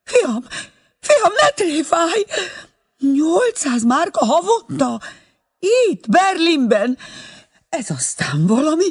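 amazed and fussing, voice pitched high; astonished old mother on phone. Acted. (elképedt anya magas telefonhangja)

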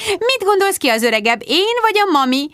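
high-pitched, playful singsong question; mischievous, high coaxing voice; playful teasing. A narrator's reading. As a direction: tease a small child in a high voice. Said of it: "folytatta dévajul Rebecca".

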